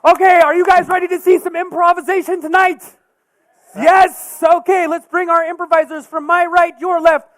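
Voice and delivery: loud, high show announcer. Acted. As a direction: hype up the introductions. Introduces improvisers to crowd.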